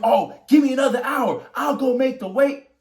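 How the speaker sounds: loud, high pleading shout; retold screaming. Boxer retelling opponent yelling for another hour, high loud shout. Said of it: "He's screaming".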